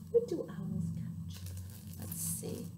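hushed, suspenseful aside; conspiratorial whisper. Real, not acted. Storyteller asks children to guess, hushed whisper. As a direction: whisper the question to the listeners.

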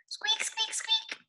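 very high, soft squeaks; mouse squeaks. Acted. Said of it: "Mice go".

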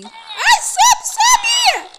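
shrill panicked scream; terrified scream. Real, not acted. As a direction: scream as she grabs you. Caught while climbing.

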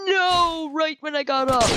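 high-pitched cry of protest; dismayed whine. Real, not acted. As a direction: wail in frustration at bad timing. Killed right after getting up.

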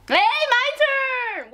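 excited. Real, not acted. Excited shout.

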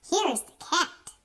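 ordinary delivery, nothing marked

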